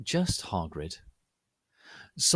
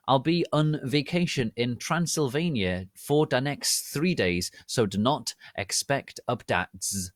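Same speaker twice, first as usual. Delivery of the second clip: plain reading voice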